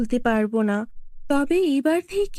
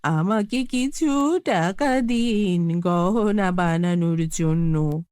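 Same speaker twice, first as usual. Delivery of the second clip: put-on old woman pleading; 6 semitones lower, slower than usual. Old woman begging the tree.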